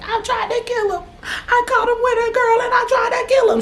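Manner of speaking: high, frantic shouted confession, far above her own pitch; frantic cellmate. Retold or imitated. A cellmate freaking out in the holding cell, shrieking high. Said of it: "She was like".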